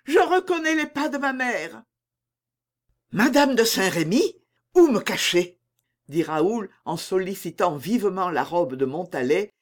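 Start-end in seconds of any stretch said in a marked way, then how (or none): 0.0-1.8 high, anxious voice; alarmed cry. A narrator's reading. Louise recognises her mother's steps, alarmed. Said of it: "s'écria Louise". 3.1-5.6 mild panic, pitch raised; panicked. A narrator's reading. Raoul begs Montalais to hide him, panicking. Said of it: "semblait un peu avoir perdu la tête".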